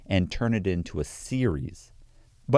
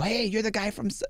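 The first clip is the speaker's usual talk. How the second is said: much higher, hammed-up gasp of recognition; mock recognition; 12 semitones higher, 3 dB quieter than usual. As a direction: squeal like someone spotting a celebrity. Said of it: "they go".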